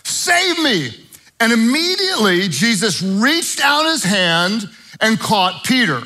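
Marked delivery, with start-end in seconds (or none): 0.0-0.9 high, slow mock plea; a lightly acted sinking man's cry; retold cry for help. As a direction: stretch out a desperate cry for rescue. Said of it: "he cried out".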